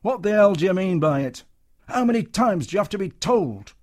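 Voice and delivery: a furious manager tearing a strip off someone. Acted. Comedian shouting at a soldier, furious and loud. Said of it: "a raised voice".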